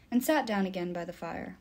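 usual speaking voice

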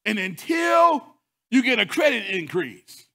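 loud, high preaching, hammering each point; full-force preaching. Acted. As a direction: preach it hard to the congregation. Preacher rises on credit card story.

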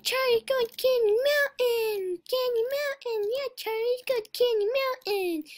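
very high squeaky unicorn voice; excitable high-pitched unicorn impression; pushy cartoon unicorn. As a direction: badger Charlie in a high, stretched squeal. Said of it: "It's like".

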